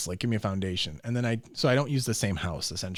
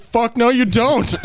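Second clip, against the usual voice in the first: loud dad refusal; 12 semitones higher, 12 dB louder, slower than usual. Retold or imitated. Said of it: "He's like".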